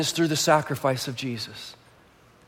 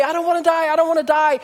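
put-on higher, repeating the little girl's wail; child's cry; 9 semitones higher, faster than usual. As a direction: echo the scared child. Child's cry retold again.

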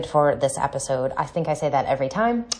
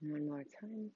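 soft, unhurried meditation voice with long pauses; guided meditation; 3 semitones lower, 12 dB quieter than usual. Acted. Coach leads listeners through breathing and visualizing their dream life, guided meditation. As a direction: guide them gently through breathing and imagining, softly.

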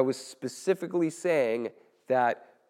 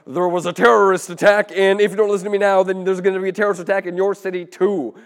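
loud, high, fast breaking news; urgent news reporter; 5 semitones higher, 10 dB louder, faster than usual. Shown as a demonstration. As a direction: alarm them like live news. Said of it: "like an urgent news reporter".

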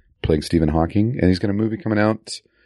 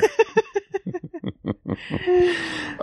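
big laugh; 4 dB quieter than usual. Real, not acted. Laughing after own impression.

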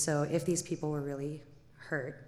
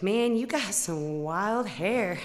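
slow drawled trucker line; 3 semitones higher, 5 dB louder, much slower than usual. Retold or imitated. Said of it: "he's like".

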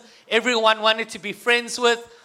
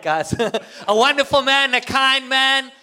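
ordinary talk, nothing marked

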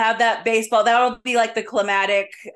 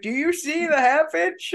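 imitating dad; 9 semitones higher than usual. Dad voiced again.

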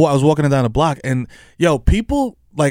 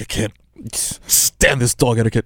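voicing the dog; 4 semitones lower, 4 dB quieter, much slower than usual. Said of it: "It's like".

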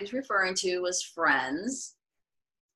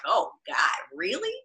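disbelief; 3 semitones higher, slower than usual. Retold or imitated. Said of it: "It's like".

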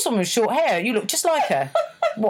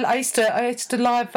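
ordinary talk, nothing marked

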